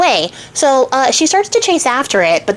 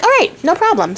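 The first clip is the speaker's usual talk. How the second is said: lightly voiced high frog; little frog; 8 semitones higher, faster than usual. Frog agreeing to fetch the ball, lightly high-pitched. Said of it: "the frog is like".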